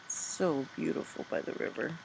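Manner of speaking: breathy whisper of "So beautiful by the river"; hushed wonder in a whisper. A narrator's reading. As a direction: whisper it like a secret. Echoing line, whispered.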